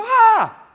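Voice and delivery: loud high mock child wail; child's wail. Toddler copying the parent's panic, loud high wail. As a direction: wail back like a startled toddler.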